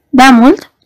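a hint of a clipped question through the teeth; through his teeth. A narrator's reading. Doctor questions the poet's friend, through teeth. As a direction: ask it barely opening your mouth. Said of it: "întrebă printre dinți doctorul".

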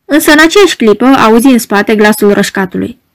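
ordinary delivery, nothing marked

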